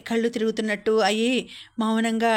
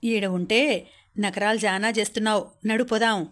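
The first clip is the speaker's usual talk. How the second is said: ordinary delivery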